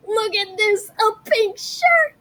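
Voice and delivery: high, slow, thrilled squeal; delighted squeal. Acted. Boy thrilled with his new pink shoes, high gush.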